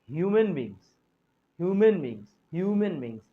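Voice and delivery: ह्यूमन said with a clear h, as in hyoo-man; h pronounced. Shown as a demonstration. Teacher repeats ह्यूमन beings to show the h. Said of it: "एच का ध्यान दीजिएगा".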